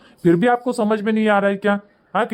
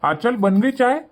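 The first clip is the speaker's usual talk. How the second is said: high-pitched questioning call; raised pitch, rising at the end; 5 semitones higher, slower than usual. Acted. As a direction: call through to the kitchen for tea. Husband calls to wife again.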